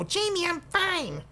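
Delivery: put-on high, slow childish voice; silly little voice. Mocking a viewer's comment in a high, childish voice. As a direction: talk like a sulky little kid. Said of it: "don't know why I use that voice".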